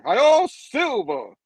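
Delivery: high, loud, drawn-out holler; hammed-up rallying cry; cowboy rallying call. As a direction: cry it out like a cowboy, stretched long. Redoes the cowboy call in full.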